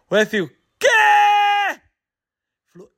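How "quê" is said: high shocked yelp of Quê; stunned shout. Acted. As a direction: gasp it out in disbelief. Farmer stunned by huge price. (grito agudo de espanto)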